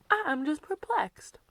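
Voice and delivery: normal talking voice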